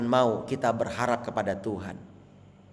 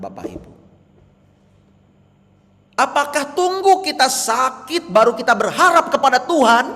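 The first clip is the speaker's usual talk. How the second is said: high, loud preaching; fiery preaching; 9 dB louder than usual. Real, not acted. Pastor challenging the congregation, loud and high. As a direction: hammer the question home.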